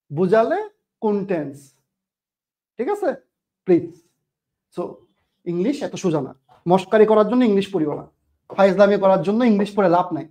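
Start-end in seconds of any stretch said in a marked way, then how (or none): none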